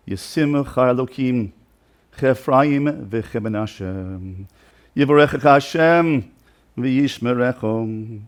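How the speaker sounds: drawn-out blessing, words held long; slow meaningful blessing. Retold or imitated. Father blessing his son David, slow and unhurried. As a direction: bless him slowly, word by word.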